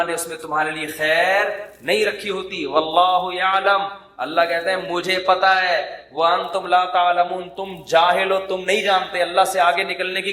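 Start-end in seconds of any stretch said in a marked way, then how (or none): 2.7-3.8 slow recited Arabic; Quran recitation. Acted. 6.1-7.5 slow recited Arabic; Quran recitation. Acted.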